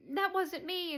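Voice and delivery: hammed-up whiny denial; high protesting denial. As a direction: protest your innocence in a mock squeal. Dottie denying breaking egg.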